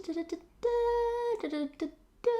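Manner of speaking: high beeping da da da; imitating a beeping parking sensor. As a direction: mimic a parking sensor's beeps. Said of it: "really high-pitched".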